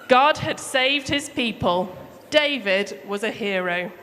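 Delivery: ordinary delivery, nothing marked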